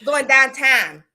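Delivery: loud Pittsburgh accent, "downtown" as "dahntahn". Shown as a demonstration. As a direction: show off broad Pittsburgh vowels. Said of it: "the most disgusting, ugliest accent".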